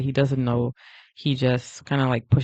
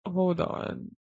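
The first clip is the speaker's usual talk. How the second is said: usual speaking voice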